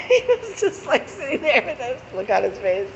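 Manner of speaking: high, laughing through the words; can't stop laughing while telling it; a real laugh running through the line. Real, not acted. Laughing while recalling date.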